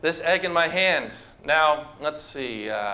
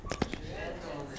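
Gentleness. almost breathed, slow and much softer; hushed; 2 semitones lower, 14 dB quieter, slower than usual. Acted. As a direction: let Gentleness. fall to a hush. Preacher savours the key word.

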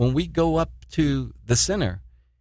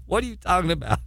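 laughing disbelief; 4 semitones higher than usual. Retold or imitated. Retelling reaction to protest girl.

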